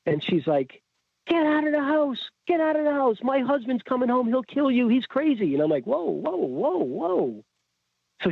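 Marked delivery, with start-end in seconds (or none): 1.2-5.5 high-pitched frantic warning; retold hysterical plea; frantic wife. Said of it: "my wife is frantic". 5.9-7.4 high, swooping string of whoas; retold alarmed attempt to slow someone down; startled calming whoas. Reacting to frantic wife, retold.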